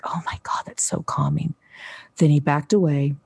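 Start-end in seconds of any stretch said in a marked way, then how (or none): 0.0-1.5 whispered in awe, much softer; awed whisper. Retold or imitated. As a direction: breathe it out in wonder. Child soothed by loving touch.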